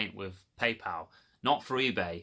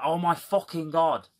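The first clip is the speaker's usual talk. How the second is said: higher, louder furious exclamation; exasperated outburst; 8 semitones higher, 6 dB louder than usual. Real, not acted. Venting to viewers about the double refund, loud exasperation. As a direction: blow up in disbelief.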